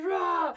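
high, sudden mock roar; short acted beast roar; imitated gorilla roar. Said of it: "And he roars".

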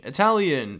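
usual speaking voice

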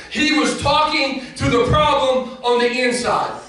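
high, loud preaching shout; full-force preaching. Acted. Preacher shouting to the congregation at full force. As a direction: preach it out to the crowd.

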